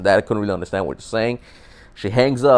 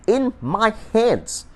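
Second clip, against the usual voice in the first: much higher, very slow, strained disbelief; squeaky exasperated echo; 10 semitones higher, much slower than usual. Real, not acted. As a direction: squeeze it out, stretched and high. Repeats for emphasis, exasperated.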